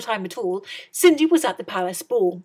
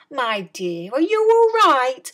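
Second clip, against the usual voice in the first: high, slow fairy godmother; fairy appears and asks Cindy kindly, in a high voice; 4 semitones higher, 7 dB louder, slower than usual. A narrator's reading. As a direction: sing it out sweetly, high and slow. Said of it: "she said".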